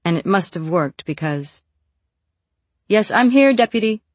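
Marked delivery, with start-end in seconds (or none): 2.9-4.0 calling down loudly. A narrator's reading. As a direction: call out from upstairs. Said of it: "was all she called back".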